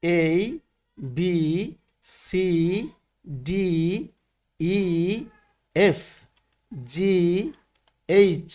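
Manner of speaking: letters one by one, long pauses between; measured model reading of the alphabet; alphabet recitation. Shown as a demonstration. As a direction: recite each letter name clearly, leaving room to repeat. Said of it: "অর্থাৎ আমরা পড়ব".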